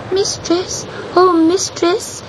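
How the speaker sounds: very high little cat voice, calling; kitten crying out. A narrator's reading. Kitten calling to the witch over a storm, high-pitched cry. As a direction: call out pleadingly in a tiny high voice. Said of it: "he cried above the storm".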